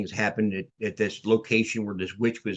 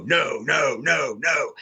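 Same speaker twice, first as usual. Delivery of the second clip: lightly mimicked ghost box, a bit higher; spooky box voice; 4 semitones higher, slower than usual. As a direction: imitate the eerie device answering no. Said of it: "it would go".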